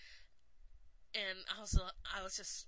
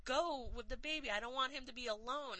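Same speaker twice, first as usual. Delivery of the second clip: retold screaming; 6 semitones higher than usual. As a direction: scream at him to shut up and go, as a retelling. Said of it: "she's just screaming at him".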